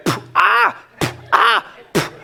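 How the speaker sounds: puffed pops alternating with high yelps; imitated shots and yelps. Dad re-enacting son's repeated tooth shots, popping and yelping. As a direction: repeat a dart pop, then a mock squeal. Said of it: "Over and over again".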